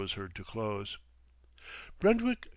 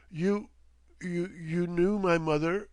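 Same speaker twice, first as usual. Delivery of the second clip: 크게 감동해 떨리는 목소리로 외치는 도로시; a high, louder cry of disbelief; moved outcry; 7 semitones higher, 5 dB louder than usual. A narrator's reading. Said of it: "cried Dorothy, profoundly moved".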